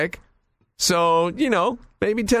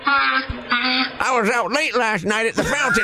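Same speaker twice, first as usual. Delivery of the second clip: lightly higher scruffy pigeon voice; 5 semitones higher than usual. Pretend. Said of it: "this is Bielko coming for his breakfast".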